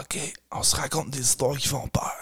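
soft unvoiced whisper. Kid whispering to siblings about scary stories. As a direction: whisper the plan.

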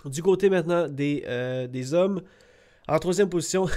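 ordinary delivery

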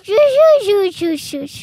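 chou crié fort qui s'éteint. Real, not acted. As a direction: crier dans le micro puis baisser. L'enfant crie fort au micro. (enfant qui crie dans le micro)

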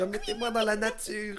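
high laughing mouse plea; squeaky voice, giggling through it; fluttering cries for help. Pretend. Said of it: "est-ce qu'ils crient pendant qu'ils sont en cage".

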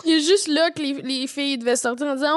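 ordinary delivery

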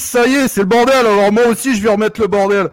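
loud, high-pitched rant; irritated outburst. Acted. Guest tells off the hosts for interrupting, angry outburst. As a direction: blow up at the interruption.

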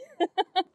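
quick amused laugh at herself; a brief self-amused chuckle; a small real laugh; short laugh. Real, not acted. Laughs at own realization.

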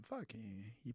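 quiet inner curse. Retold or imitated. Coach's inner thought.

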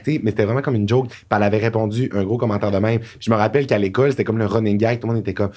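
ordinary talk, nothing marked

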